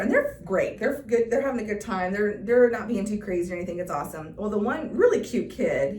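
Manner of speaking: usual speaking voice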